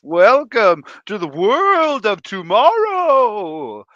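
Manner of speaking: loud, slow announcer boom; Futurama announcer imitation. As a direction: proclaim it like a grand futuristic welcome. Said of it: "the first thing the guy says is".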